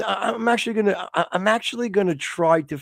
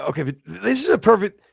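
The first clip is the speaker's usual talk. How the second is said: normal talking voice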